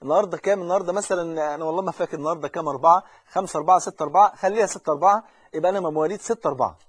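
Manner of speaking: usual speaking voice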